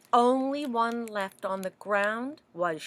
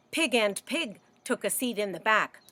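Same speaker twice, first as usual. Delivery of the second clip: plain reading voice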